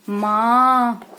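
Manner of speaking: माँ very slow, air through nose and mouth; nasal माँ demo. Shown as a demonstration. Teacher showing anunasik to children, long nasal माँ. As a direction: hold the nasal vowel of माँ. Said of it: "नाक और मुंह से".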